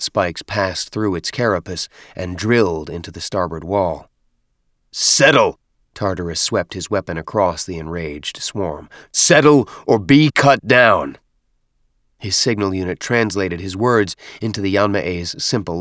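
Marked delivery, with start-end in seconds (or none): 4.9-5.6 loud, high barked order; shouted command. Acted. Warrior orders enraged swarm down. 9.1-11.2 slow, loud, high warning shout; drawn-out commanding yell; shouted threat. Acted. Warrior threatens enraged swarm.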